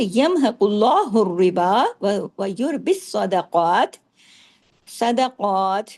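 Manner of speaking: slow, chanted tajweed recitation; Quran recitation. Shown as a demonstration. Teacher models the verse for students, slow chanted recitation. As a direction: intone the verse slowly as a model. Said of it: "اس طرح پڑھیے".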